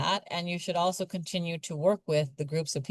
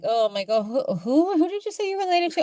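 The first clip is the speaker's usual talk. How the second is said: mock outsider; 12 semitones higher than usual. Mocking outsider's evasive reaction.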